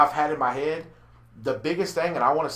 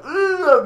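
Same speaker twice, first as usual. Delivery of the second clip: mock ugh; 8 dB louder than usual. Acted leer reaction in skit.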